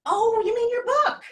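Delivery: high, loud, eager question; bright wife reply. Retold or imitated. Wife guessing the husband's lost word, high and eager.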